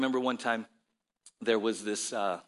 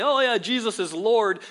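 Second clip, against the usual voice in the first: mock cliche; 8 semitones higher, 6 dB louder than usual. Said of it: "it's become very cliche".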